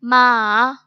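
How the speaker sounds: मा dipping down, then climbing back up; long loud मा with a scooped pitch; third-tone मा, drawn out through a dip; falling-rising third tone. Shown as a demonstration. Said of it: "falling and rising tone".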